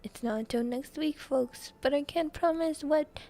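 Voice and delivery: high, loud TV weatherman; hammed-up weather forecaster with a put-on accent; Jim's voice. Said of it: "I had to do Jim's voice".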